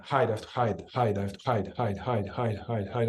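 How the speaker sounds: low, softened, quick repetition; rapid fire. Shown as a demonstration. Facilitator voicing the inner command for the listener, rapid-fire. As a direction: drum the word out fast and low. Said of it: "I'm doing it fast now".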